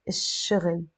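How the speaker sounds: الشغل as ish-shughl, sh held; silent L, doubled sh. Shown as a demonstration. Teacher repeating the sun-letter form, L silent. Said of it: "without the L sound".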